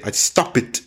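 "stop it" with an extra syllable, STOP-it; Brazilian learners' mispronunciation. Retold or imitated. Teacher imitating Brazilian students, extra-syllable ending. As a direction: make the ending of "stop it" a separate IT. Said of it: "A lot of Brazilians say".

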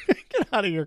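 ordinary delivery

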